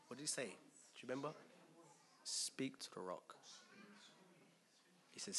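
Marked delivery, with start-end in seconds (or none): 2.2-3.3 hushed command. Acted. God's command to Moses.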